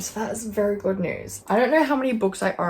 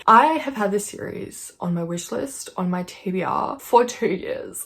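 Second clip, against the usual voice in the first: ordinary talk, nothing marked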